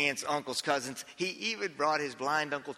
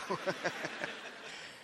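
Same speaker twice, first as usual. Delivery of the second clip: soft laugh; 3 semitones higher, 8 dB quieter than usual. Real, not acted. Laughing at own story.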